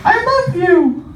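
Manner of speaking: put-on high squeaky little kid calling; child voice. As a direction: pitch way up like a small child. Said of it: "the kid will be like".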